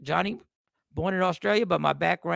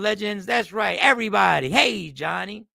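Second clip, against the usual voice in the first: usual speaking voice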